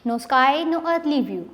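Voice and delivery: ordinary delivery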